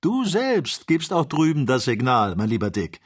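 high, lively, amused answer; reply merrily, pitch lifted; cheerful, teasing liveliness. A narrator's reading. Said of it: "erwiderte Ferguson lachend".

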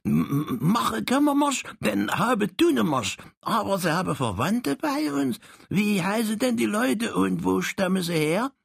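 high, eager little Saxon man. Acted. Saxon character boasts to Frank, high and eager. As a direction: squeak it out like a proud little Saxon.

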